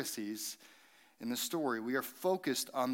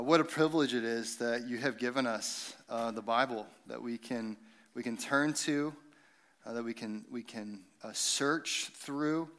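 prayer; 3 semitones lower than usual. Acted. Pastor's opening prayer.

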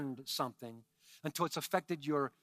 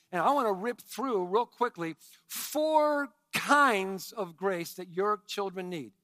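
ordinary talk

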